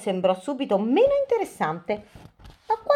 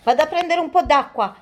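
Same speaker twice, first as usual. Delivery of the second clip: higher, urgent exclamation; exclaiming; 7 semitones higher, 4 dB louder than usual. A narrator's reading. Rooster rushing off to help injured friend, exclaiming. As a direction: call it out as you dash off. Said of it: "esclamò Pieretto".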